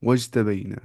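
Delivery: slow, stretched recitation of each word; Quran recitation. Shown as a demonstration. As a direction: draw out the ghunna, very slow. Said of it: "मिम्मन हदैना वज्तबैना".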